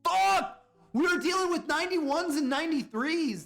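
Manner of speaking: high loud exclamation; shocked. Real, not acted. Reacting to the close votes with a high shocked outburst. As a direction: blurt it in disbelief.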